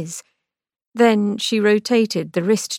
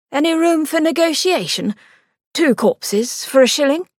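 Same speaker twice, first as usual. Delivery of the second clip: higher, lightly voiced bargaining brother; Victor, haggling; 7 semitones higher, 3 dB louder than usual. A narrator's reading. Victor haggling with the attendant, cheeky.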